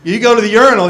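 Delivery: ordinary talk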